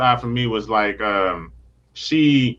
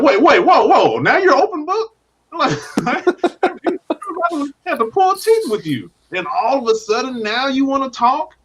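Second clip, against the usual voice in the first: mock disbelief; 9 semitones higher, slower than usual. Fan mocking Bucky's sudden openness, high incredulous laughter.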